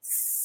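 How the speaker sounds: long sssss hiss for "s"; "s" hissed like a snake; snake's S sound. Shown as a demonstration. Teacher showing the snake sound for children, long hiss. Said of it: "é o som da serpente".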